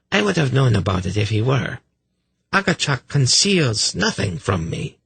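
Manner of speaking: a touch of indignant authority; haughty priestess. A narrator's reading.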